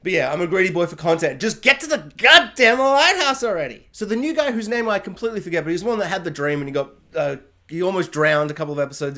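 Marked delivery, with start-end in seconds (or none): 1.4-3.9 higher and louder, fed up; half-joking frustrated yell; exasperated outburst. Real, not acted. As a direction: blurt it out like an impatient fan. Fan frustrated with slow plot.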